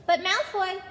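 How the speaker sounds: high, loud protest; protesting boy. A narrator's reading. Ron protesting to the teacher, high loud protest. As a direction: cut in in protest.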